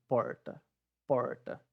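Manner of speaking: porta with a retroflex throaty R; paulista R. Shown as a demonstration. Teacher asking students to think of Paulista porta, throaty R. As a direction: say porta like a Paulista, English-style r. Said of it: "esse porta de paulista".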